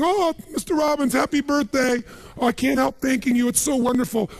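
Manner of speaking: high-pitched, delighted gushing maid. Retold or imitated. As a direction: gush sweetly at the boss in a high voice. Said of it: "my maid, she's back at home in the castle going".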